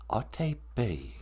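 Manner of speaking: Atebi said slowly and softly; slow Ojibwe teaching. Shown as a demonstration. Teacher slowly repeating Atebi for the audience. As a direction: draw out Atebi slowly for learners. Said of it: "if you wanna sober up".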